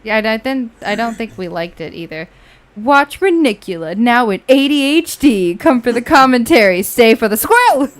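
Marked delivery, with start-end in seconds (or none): 2.8-7.9 loud, raised-pitch show promo; promo announcer. Shown as a demonstration. Player demos channel slogan for friend, announcer read. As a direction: pitch it like an excited trailer read.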